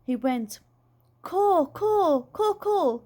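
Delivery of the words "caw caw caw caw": high, drawn-out crow calls; Dad's pretend crow cry; crow cawing. A narrator's reading. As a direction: caw just like a crow. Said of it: "just like a crow".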